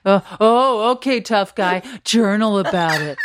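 a touch of hammed-up tough talk; mock intense. Co-host mimicking an intense exchange over journaling, mock intense. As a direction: push it as a mock-intense comeback. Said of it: "like, a really intense".

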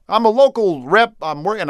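a hint of a busy politician's voice; Barack Obama. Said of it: "President Barack Obama, and he goes".

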